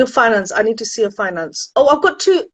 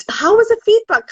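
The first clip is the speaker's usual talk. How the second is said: excited; 11 semitones higher, 4 dB louder than usual. Real, not acted. Sudden excited question to viewers.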